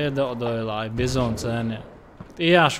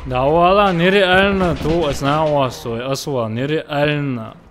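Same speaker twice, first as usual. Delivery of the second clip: excited shouting; 7 dB louder than usual. Real, not acted.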